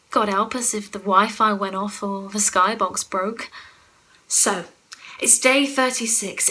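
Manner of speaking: ordinary talk, nothing marked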